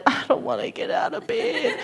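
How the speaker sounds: quiet, high moan of reluctance; inward whine. Retold or imitated. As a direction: imitate a silent complaint, breaking into a laugh. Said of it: "I'm inside internally".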